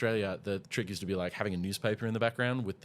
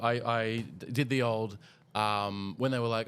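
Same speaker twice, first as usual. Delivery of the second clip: ordinary talk, nothing marked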